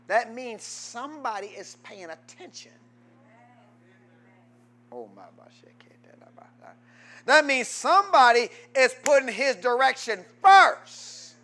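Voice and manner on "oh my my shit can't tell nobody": whispered aside. Real, not acted. Aside to himself mid-sermon.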